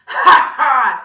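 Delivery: very loud, high ha-ha; gloating villain laugh. A narrator's reading. Villain mocking a crying boy, gloating. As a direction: cackle triumphantly at full volume.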